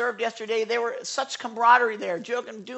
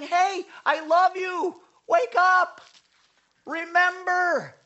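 God's loving call; 10 semitones higher, slower than usual. Acted. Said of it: "It's like God saying".

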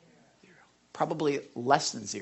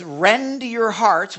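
very slow and louder, leaning on "your"; slow emphatic; 4 semitones higher, 9 dB louder, much slower than usual. Acted. Pastor charging the church to repent, slow and forceful. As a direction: draw out "Rend your hearts" with weight. Preacher reading a charged verse.

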